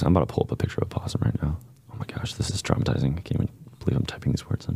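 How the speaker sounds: fast unvoiced whisper; hushed, hurried dread. Real, not acted. Whispering while searching possum.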